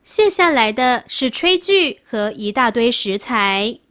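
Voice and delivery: ordinary delivery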